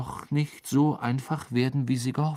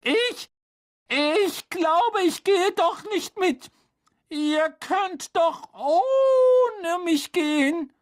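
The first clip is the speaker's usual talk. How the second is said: put-on very high, shrill girl figure; 5 dB louder, slower than usual. A narrator's reading. Hermine refuses from behind the door, shrill. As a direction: squeal it out, reluctant and strained. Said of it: "Eine schrille Stimme antwortete".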